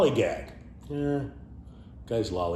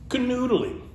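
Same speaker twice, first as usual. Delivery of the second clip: Canoodling squeaked very high. Real, not acted. Introducing a cute word, delighted squeal. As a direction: pitch Canoodling way up with glee.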